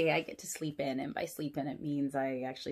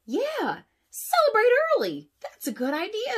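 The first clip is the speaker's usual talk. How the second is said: very high, loud, brightening tow truck; Mater's voice; 7 dB louder than usual. Acted. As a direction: perk up at the idea. Mater perking up.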